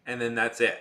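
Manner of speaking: ordinary talk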